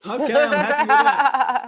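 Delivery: high, bright laughing reply. Real, not acted. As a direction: laugh the words out as you agree. Laughing at the joke.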